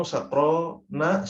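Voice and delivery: sakrona stretched out slowly with a rising end; teacher spelling out sakrona slowly; slow careful demo. Shown as a demonstration. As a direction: draw out sakrona so students hear the -a ending. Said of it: "Ya, sakronu".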